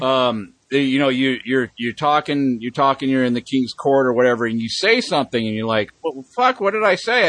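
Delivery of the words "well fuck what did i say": high, startled mock panic; a doomed courtier's sudden alarm; a lightly acted frightened subject; panicked courtier.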